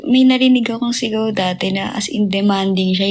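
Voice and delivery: ordinary talk, nothing marked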